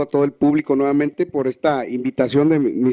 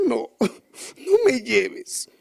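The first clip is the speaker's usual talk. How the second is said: borrachito suplicando; 7 semitones higher, much slower than usual. Acted.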